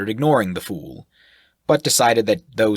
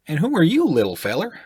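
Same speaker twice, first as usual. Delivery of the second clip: put-on much higher, talking down to a child; patronizing; 10 semitones higher, 4 dB louder than usual. The sailor squats and talks down to the gnome, patronizing. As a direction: croon it down to someone small. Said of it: "speak so patronizingly".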